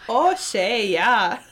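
put-on loud, jokey Midwestern accent; hammed-up Midwestern. Co-host joking about Shay, hammed-up Midwestern. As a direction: play up the Midwestern vowels loudly. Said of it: "I sounded so Midwestern".

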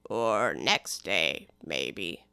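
very low, slow, indifferent drawl; a bored servant, low and slow; slow deep footman. Acted. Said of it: "continued in the same tone".

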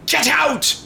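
high-pitched acted yell; mock yell. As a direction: yell it like an angry doctor, hammed up. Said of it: "But the Doctor just yells".

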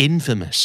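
infamous stressed on the first syllable, IN-famous; correct pronunciation. Shown as a demonstration. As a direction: say infamous with the weight on the front. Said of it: "มันออกเสียงอย่างนี้". (เน้นพยางค์แรก infamous)

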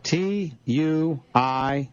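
slow letter by letter; spelling it out. Shown as a demonstration. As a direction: spell each letter deliberately. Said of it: "read off the letters".

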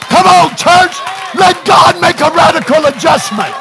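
rising to a high, loud revival shout; full-force preaching. Real, not acted. Preacher urges church to see stress as dessert, full-force preaching. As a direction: whip the congregation up as it builds.

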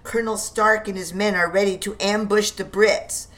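ordinary delivery, nothing marked

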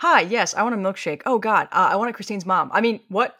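loud, flustered ordering a milkshake; hammed-up panicked order; flustered mock order.

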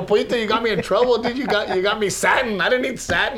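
playful whine; mock complaint. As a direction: gripe about the wrong paint. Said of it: "I go".

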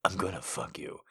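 low, soft, breathed words; breathy whisper. Acted. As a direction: breathe it into her ear. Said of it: "he breathed into her ear".

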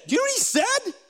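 high-pitched amazed question; incredulous. Real, not acted. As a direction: make them lean in with disbelief. Preacher stressing shocking reply.

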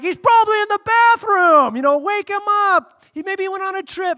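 high, loud sneering voice; mocking taunt. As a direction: ridicule them in a put-on voice. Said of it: "making fun of them".

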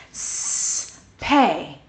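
long soft hiss, then the syllable pay; drawn-out s sliding into pay. Shown as a demonstration. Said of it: "Can you do that with me again?".

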